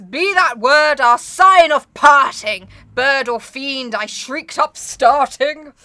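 slow, loud, declaiming poem reading; dramatic slow recitation. Acted. Narrator banishing the Raven, read slow and theatrical. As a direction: thunder the curse at the bird.

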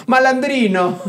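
high, laughing Italian flourish; mock-Italian exclamation, pitched high; playful Italian. Shown as a demonstration. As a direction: say it with a hammed-up Italian lilt. Shows off the Italian word, laughing.

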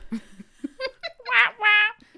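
loud hammed-up baby wail; mock crying wah. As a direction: wail like a fake crybaby. Mock crying after joke.

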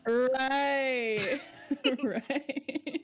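laughing through the word. Real, not acted. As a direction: giggle while agreeing. Laughing at the blimp memory.

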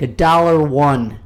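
cheeky bidder saying a dollar one; slow, loud contestant voice. Retold or imitated. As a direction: ham up the game-show bid. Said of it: "that one guy's like".

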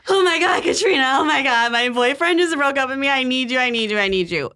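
high-pitched hysterical plea, imitated; rushed, high wail that gradually drops; mock frantic. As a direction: act out a frantic friend begging down the phone.

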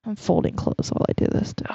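hushed aside; whisper. Real, not acted. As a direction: whisper it quietly.

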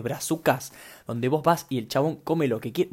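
normal talking voice

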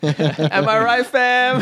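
high slow teasing echo; mocking villain voice. Echoes the villain line mockingly.

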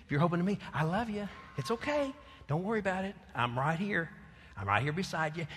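put-on calm a frightened child; God comforting. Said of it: "But God says".

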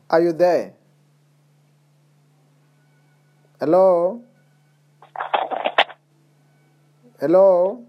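calling out down a bad line, building up; shouting into a phone. Real, not acted. Caller can't hear, calling out.

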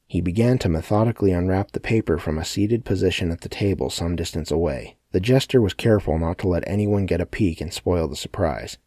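plain reading voice

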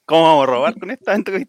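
fast, loud and high joking exclaim; excited. Real, not acted. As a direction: blurt it out, thrilled. Exclamación en broma, muy fuerte. (exclamación emocionada y rápida)

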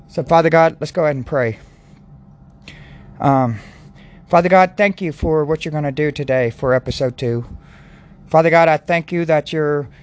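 low, earnest praying voice; prayer. Acted. Leads a prayer.

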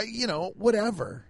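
ordinary talk, nothing marked

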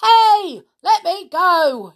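high, loud panicked yell. Acted. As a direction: yell while dangling upside down. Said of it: "yelled the enormous crocodile".

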